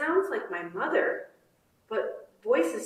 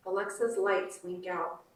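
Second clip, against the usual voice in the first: usual speaking voice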